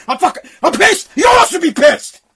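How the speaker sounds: high, loud, furious outburst; real angry shout. Real, not acted. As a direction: blow up and yell at the audience.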